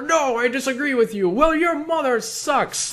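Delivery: very high, hammed-up retort; escalating mock argument. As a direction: escalate into a high, drawn-out childish insult. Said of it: "you're having an argument with someone".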